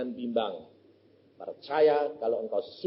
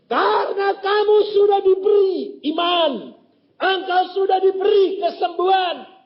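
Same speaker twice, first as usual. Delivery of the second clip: much higher, louder stern rebuke; firm rebuke; 14 semitones higher, 9 dB louder than usual. Retold or imitated. Preacher scolding the young man for weak faith, loud and high. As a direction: preach it at him with force.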